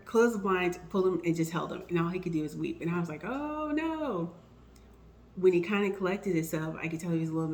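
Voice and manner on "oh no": much higher, dismayed cry of sympathy; sympathetic oh no. Retold or imitated. Colleague breaks down crying at her door, dismayed sympathy. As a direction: melt at someone's tears. He can only weep.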